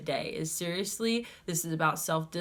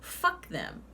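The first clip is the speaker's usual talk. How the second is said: usual speaking voice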